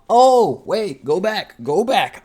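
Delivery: a loud, high burst of oh wait, go back; catching himself after skipping a scene; sudden realization. Real, not acted. Mid-recap, realizing he skipped the flashback, loud and surprised.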